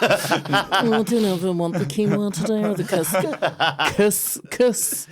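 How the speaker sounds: slow, high, drawn-out posh cafe customer; mock the snooty cafe crowd ordering lunch. Mocking posh cafe customers dithering over quinoa, slow high drawl. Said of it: "that voice will always drive me up a wall".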